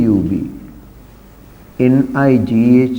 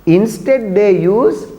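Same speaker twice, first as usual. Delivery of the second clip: ordinary delivery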